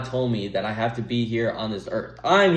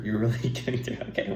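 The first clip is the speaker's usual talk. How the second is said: stifled giggle mid-sentence; can't keep a straight face; laughing; 6 dB quieter, faster than usual. Real, not acted. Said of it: "Why am I laughing?".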